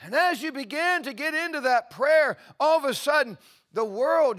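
loud, high, soaring preacher's cry; preaching at full force. Acted. Preacher lifting the congregation into prayer, full force.

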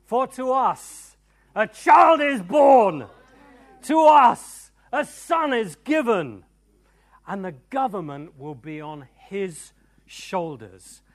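high, loud proclamation building to a shout; rousing full-force preacher delivery. Acted. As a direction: thunder out the scripture, drawing out key words.

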